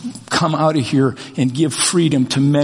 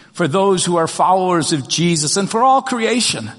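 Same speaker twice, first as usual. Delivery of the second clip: ordinary talk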